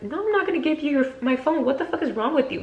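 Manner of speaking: high-pitched retold protest; indignant refusal. Refusing the man demanding her phone, indignant.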